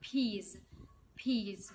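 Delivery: Peas said with a crisp, popped P at the start; Peas, opening with a sharp P; clear P sound. Shown as a demonstration. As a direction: hit the P of Peas cleanly. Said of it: "نطق ال P بقى".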